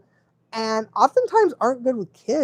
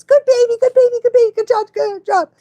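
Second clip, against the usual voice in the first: high, loud sing-song praise for a puppy; dog praise baby talk; 13 semitones higher, 7 dB louder than usual. Shown as a demonstration. Trainer gushing praise at a dog that peed outside, high sing-song. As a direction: gush over the dog in a high voice.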